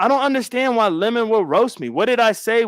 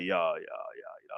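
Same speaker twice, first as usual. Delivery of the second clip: very low, quiet put-on deep voice; 17 dB quieter than usual. Showing how others sound waking up, very low, quiet put-on deep voice. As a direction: drop it way down, like just woke up. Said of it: "my voice is high".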